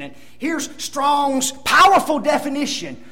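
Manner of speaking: normal talking voice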